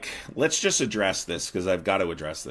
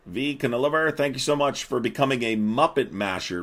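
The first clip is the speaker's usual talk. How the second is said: usual speaking voice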